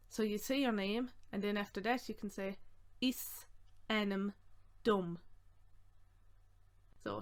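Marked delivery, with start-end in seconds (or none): 3.0-5.2 one word at a time; measured dictation for learners; slow breakdown. Shown as a demonstration. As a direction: separate each word with a pause.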